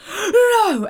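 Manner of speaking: high, loud mock-disbelieving cry; shocked exclamation. Acting out people's shock at a crazy story, shocked exclamation.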